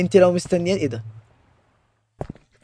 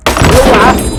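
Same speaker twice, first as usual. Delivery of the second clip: loud, high warning yell; startled cry; 6 semitones higher, 10 dB louder, much slower than usual. Real, not acted. Player startled by the monster, loud high yell. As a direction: jump and shout a warning.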